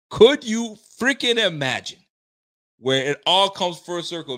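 real fan getting carried away; hyped amazement. Real, not acted. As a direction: burst out in disbelieving excitement. Repeats it, hyped at the prospect.

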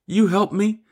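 put-on high, rising child's plea; childlike faith. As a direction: ask it like a trusting little child. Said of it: "with childlike faith".

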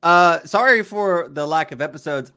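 loud, upbeat host welcome. Acted. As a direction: welcome the audience back. Host restarts show loudly.

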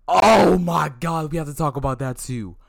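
loud, high, drawn-out oh my God; excited outburst. Real, not acted. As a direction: burst out as it hits you. Suddenly remembers a story.

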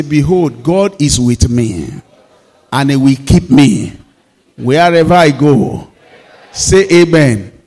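loud, slow call for the church to repeat; rousing pulpit call-and-response; congregational call. Acted. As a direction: lead the church in a loud chant. Leads congregation in loud repetition.

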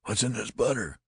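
high, alarmed hoarse question; urgent, high-pitched demand; hoarse cry. A narrator's reading. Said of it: "I cried hoarsely".

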